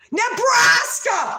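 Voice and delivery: drawn-out high shout; excited shout. Real, not acted. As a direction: belt the word out, thrilled. Excited outburst at the word.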